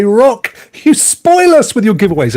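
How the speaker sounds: high, lightly voiced fan; imagined grateful viewer. Host voicing viewers praising his giveaways, imagined grateful viewer. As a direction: playfully gush like an adoring viewer. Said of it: "I hear you say".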